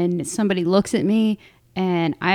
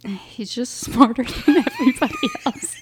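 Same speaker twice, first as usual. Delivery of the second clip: laughing; 5 semitones higher than usual. Real, not acted. Podcaster joking about their brother, laughing through the words.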